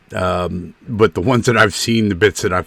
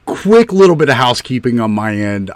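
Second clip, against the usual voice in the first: ordinary delivery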